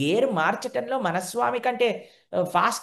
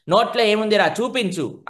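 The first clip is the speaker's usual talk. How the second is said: insisting mother; 4 semitones higher, 7 dB louder than usual. Acted.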